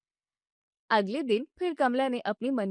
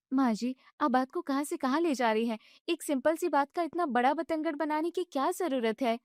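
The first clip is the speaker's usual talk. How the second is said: higher, indignant daughter-in-law voice; young wife protesting; 4 semitones higher than usual. Acted. Bride objects when mother-in-law shuts off the gas, indignant and high. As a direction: push back, hurt and annoyed.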